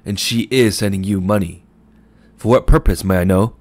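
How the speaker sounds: loud mocking challenge, rising on purpose; loud mockery. A narrator's reading. Shopman grills Mooney before the crowd, loud and mocking. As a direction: corner him with scorn.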